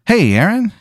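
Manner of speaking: high, casual dog greeting; cheery high dog voice; high talking dog. Acted. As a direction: pitch up like a friendly dog. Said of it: "he goes".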